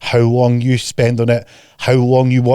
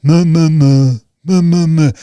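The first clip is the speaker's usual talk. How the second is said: louder bleating meh; mock moaning; 4 semitones higher, 5 dB louder, slower than usual. Coach imitating clients moaning about results. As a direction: bleat like a bored complainer. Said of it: "And you're like".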